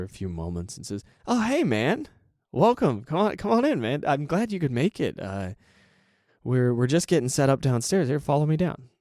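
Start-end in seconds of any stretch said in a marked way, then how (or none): 1.3-8.9 high, bright welcome, a notch louder; eager friend's voice. Retold or imitated. Friend welcoming a nervous newcomer downstairs, high and upbeat. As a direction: greet the guest like an excited host. Friend greeting you at the door.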